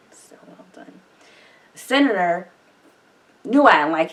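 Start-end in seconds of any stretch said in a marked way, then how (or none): 0.1-1.0 soft unvoiced whisper of praise; awed whisper. Real, not acted. Viewer whispering praise for the actor's moment. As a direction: breathe it out, almost to yourself.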